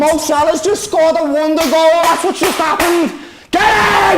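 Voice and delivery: high, loud jubilant yelling; triumphant announcement. Real, not acted. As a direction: proclaim the goal to the whole house. Yells the news of the goal.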